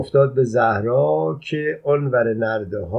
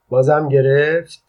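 loud taunt; 4 semitones higher, 6 dB louder, slower than usual. A narrator's reading. Son taunts father in pain.

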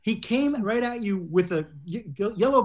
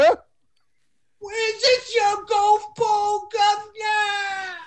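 very high, hugely drawn-out British caricature; mock British wail; much slower than usual. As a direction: stretch every word in absurd high British. Outdoing the mock British quote.

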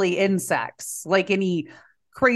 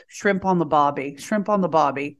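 lower, badly faked Aussie accent; practice Australian accent; 4 semitones lower than usual. Shown as a demonstration. Getting into character with a catchphrase, mock Australian accent. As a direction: warm up a fake Australian accent. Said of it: "let me get into my Australian".

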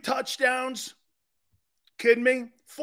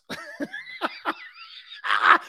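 high wheezy laugh. Real, not acted. Host cracking up at his own matchup hype, laughing. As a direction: burst of high-pitched laughter.

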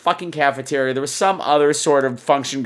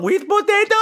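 imitated tiny high voice with an accent; high, sing-out little serving call; teeny tiny high voice. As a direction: mimic a tiny woman, pitched way up. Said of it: "little teeny tiny high voice".

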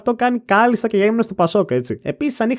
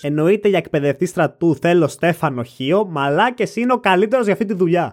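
ordinary talk

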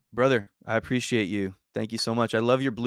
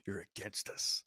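breathy hushed joke line; whispered aside; 3 semitones lower, 14 dB quieter than usual. Retold or imitated. Host whispers a joking line to the guest.

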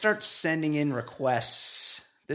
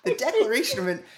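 high, fast words through laughter; squeaky incredulous laughing; laughing disbelief; 13 semitones higher, faster than usual. Real, not acted. Incredulous at a long read.

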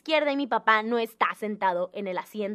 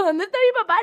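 high, loud, frightened cry for her father; a startled kid suddenly looking for papá; panicked call; 7 semitones higher, 5 dB louder than usual. Retold or imitated. After the crash, the daughter cries out in panic for her dad.

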